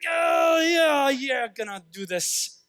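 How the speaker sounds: fired-up shout. Acted. Acted reaction to reading the book.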